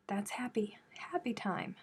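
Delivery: soft playful whisper; a little whispered celebration. Acted. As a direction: whisper the happy news to yourself. Cheery hushed aside.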